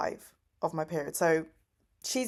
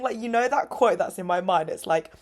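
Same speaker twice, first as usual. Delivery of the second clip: usual speaking voice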